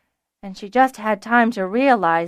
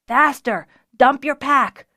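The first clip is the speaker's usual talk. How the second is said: loud, raised urging; urgent shout; 5 semitones higher, 7 dB louder than usual. A narrator's reading. Archer urging fleeing Fiona to drop her pack, urgent shout. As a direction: yell at her to hurry.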